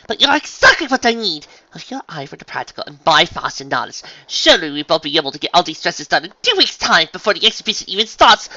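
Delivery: loud, building to high squeal; overjoyed gushing. Acted. Unicorn sees her friend as the answer, gushing excitedly.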